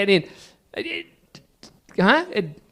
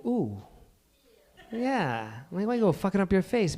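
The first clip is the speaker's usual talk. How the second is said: imitating a judging onlooker; 8 dB quieter than usual. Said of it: "you've looked at them going".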